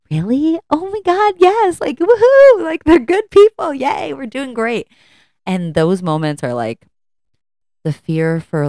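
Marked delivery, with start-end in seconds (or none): 0.1-4.9 squealing mock joy, much higher and louder; gushing delight. Mom hearing praise of her kids, squealing delight. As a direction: cheer like the proudest mom alive. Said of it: "And I'm like".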